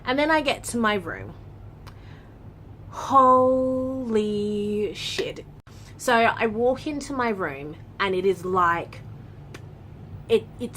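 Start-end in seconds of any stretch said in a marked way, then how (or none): 2.9-5.4 slow, stretched-out gasp of disgust; drawn-out shock. Real, not acted. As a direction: drag it out in horror. Walks into a filthy room.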